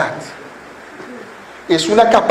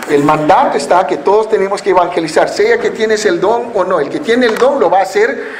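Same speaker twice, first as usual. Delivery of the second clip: ordinary delivery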